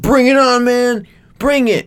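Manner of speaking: high, goading taunt; mock fighter's dare; retold challenge. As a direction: egg him on in a high voice.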